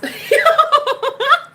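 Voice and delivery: high, loud drawn-out cackle; real cackle. Real, not acted. Host amused by own hashtag, cackling high.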